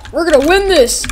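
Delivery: loud, high rallying shout; hammed-up confident yell; mock battle cry. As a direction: call it out like a cartoon fighter. Voices fighter rallying.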